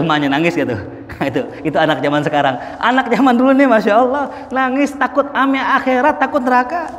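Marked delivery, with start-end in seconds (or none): 2.8-6.9 amazed, laughing. Real, not acted. Preacher comparing children of old to congregation, amazed and laughing. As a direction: marvel at it with a laugh.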